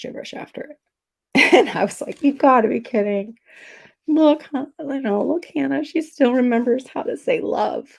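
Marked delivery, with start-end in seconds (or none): 2.2-7.9 higher, louder, in wonder at the text; amazed disbelief. Retold or imitated. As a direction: relive the moment you read the word love. Retelling her reaction in the store.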